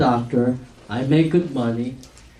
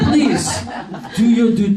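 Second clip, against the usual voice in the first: laughing plea; 5 semitones higher than usual. Real, not acted. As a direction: urge them through the laughter. Urging while still laughing.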